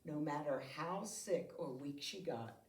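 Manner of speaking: plain reading voice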